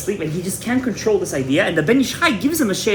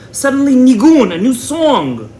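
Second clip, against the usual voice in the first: louder and higher burst of wonder; excited exclamation; 6 semitones higher, 6 dB louder, slower than usual. Retold or imitated. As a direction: announce the sudden song with awe. Climax of vision description.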